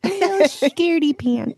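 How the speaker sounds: playfully lifted pitch; playful self-teasing. Pretend. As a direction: poke fun at being a scaredy-cat. Teasing herself for being scared.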